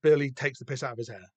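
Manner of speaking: ordinary talk, nothing marked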